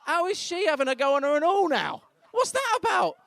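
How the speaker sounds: high, loud comic squawk; outraged disbelief. Acted. Partner watching the midwife's exam, high outraged disbelief. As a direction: climb very high in mock indignation. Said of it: "I'm sat there like".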